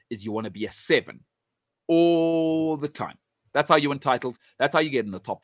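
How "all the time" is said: "all the time" stretched very slowly; pundit leans on "all the time", dragging each word out; drawn out for emphasis. Real, not acted. Pundit telling United fans what a title side needs, slow emphatic stretch.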